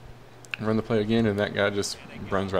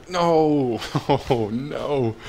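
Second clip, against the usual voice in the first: loud, high cry of dismay; 4 semitones higher, 6 dB louder, much slower than usual. Real, not acted. Gamer seeing a touchdown called back, dismayed. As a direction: cry out in disbelief, with a laugh.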